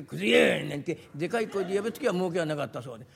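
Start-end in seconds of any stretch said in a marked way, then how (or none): 0.0-0.6 loud haughty shout from afar; proud loud call. Retold or imitated. Customer calling junkman from afar, loud and haughty. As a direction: call the junkman like you own the street. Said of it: "威張ったように遠くの方からでかい声で".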